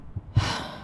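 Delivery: heavy sigh of frustration; frustrated sigh. Acted. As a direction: sigh it out, restless and stuck. Restless, cannot burn off energy.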